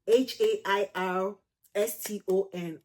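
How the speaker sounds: letters spelled slowly and clearly, one by one; spelling out slowly. Shown as a demonstration. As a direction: spell each letter out for the winner.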